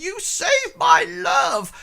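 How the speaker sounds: high, loud, slow cry of thanks; grateful cry. Acted. Character shouting thanks to the heroes.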